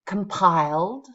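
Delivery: compiled, said slowly and clearly, stress on the second syllable; modelling a word for repetition; slow model word. Shown as a demonstration. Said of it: "Let's just say these words out loud".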